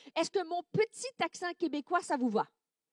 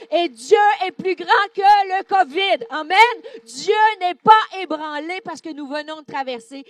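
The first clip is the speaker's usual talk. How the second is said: rising to a loud, higher preaching cry; preaching at full force; 4 semitones higher, 10 dB louder than usual. Acted. Preacher to the church, building to a loud preaching cry.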